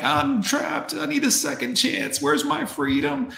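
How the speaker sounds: high, whiny mock of a trapped wife; mocking whiny woman. Streamer mocks divorced women in a high whiny voice. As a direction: whine it like a drama queen. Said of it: "And then they're like".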